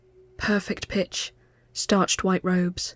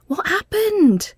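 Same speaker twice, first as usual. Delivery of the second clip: sharply higher, slow alarmed question; worried friend seeing the cut hand; 13 semitones higher, 4 dB louder, slower than usual. Acted. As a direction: rush in worried and startled. Mel rushes over, alarmed.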